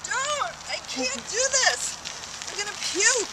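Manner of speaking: high, desperate wail; panicked whining. Real, not acted. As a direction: cry out that you can't go on. Friend struggling on bike.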